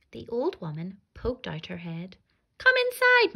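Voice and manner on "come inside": high, louder luring call; sing-song witch invitation; sugary witch. Acted. Witch lures children in.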